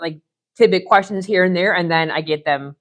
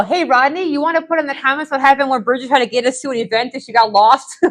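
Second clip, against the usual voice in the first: calling out; 8 semitones higher, 4 dB louder than usual. Real, not acted. Calling out to viewer Rodney.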